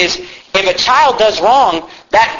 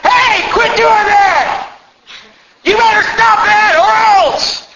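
high, drawn-out threatening shout; hammed-up angry parent yell; 3 dB louder, much slower than usual. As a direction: holler a warning across the room. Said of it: "just yell".

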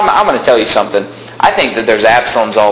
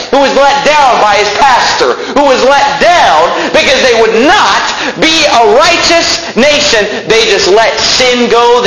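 fiery preaching; 9 semitones higher than usual. Acted. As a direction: preach it up to the rafters. Preaching at full force.

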